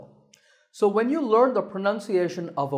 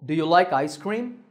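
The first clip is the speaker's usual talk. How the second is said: "Do you like ice cream" climbing on ice cream; rising tone. Shown as a demonstration. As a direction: lift the pitch at cream. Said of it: "This sentence has a rising tone".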